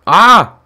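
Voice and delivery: loud, high cry of alarm; startled yell. Real, not acted. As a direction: yelp loudly as danger hits. Pickaxe threatens the streamer in game.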